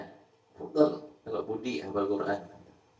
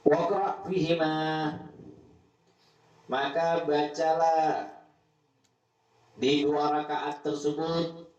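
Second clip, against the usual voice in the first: solemn recitation; 5 semitones higher, slower than usual. Acted. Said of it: "translating verse slowly".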